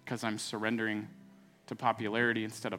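fervent spoken prayer, building in force; pastor's closing prayer voice; earnest prayer. Acted. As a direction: pray aloud over the congregation.

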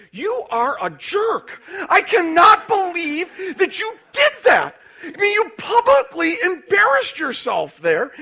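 high, loud scolding shout; loud, high scolding with a crying edge; angry wife. Retold or imitated. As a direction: shout at your husband, close to tears. Wife scolds him in the car.

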